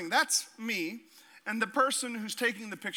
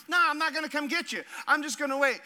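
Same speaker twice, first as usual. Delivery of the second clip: mock friend refusing; 6 semitones higher than usual. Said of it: "he was like".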